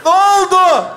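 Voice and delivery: loud high shout; calling out. Acted. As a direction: shout to people out of sight. Calls out once more.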